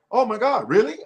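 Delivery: high-pitched mock astonishment; retold amazement. Agency staff meeting the radio voice, high imitated amazement. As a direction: imitate starstruck agents, voice leaping high. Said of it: "they were like".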